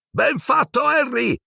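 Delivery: high, eager character voice; enthusiastic Percy. Acted. Percy praising Harry, high eager voice. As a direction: congratulate with glee. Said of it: "disse Percy entusiasta".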